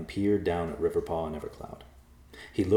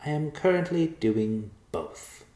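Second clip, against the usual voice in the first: slow, high, lofty mouse; air of superiority; 9 semitones higher, 3 dB louder, slower than usual. Acted. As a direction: lord it over them, higher. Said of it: "with an air of superiority".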